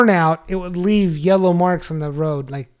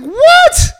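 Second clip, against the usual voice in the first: very high, loud "what" of disbelief; mock shock; 5 dB louder than usual. Husband learning wife was sarcastic, mock shock. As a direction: yelp it in hammed-up astonishment.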